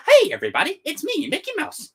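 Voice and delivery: high, squeaky cartoon mouse greeting; cheerful cartoon mouse, jumping up high; Mickey Mouse impression. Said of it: "Mickey Mouse had come in and go".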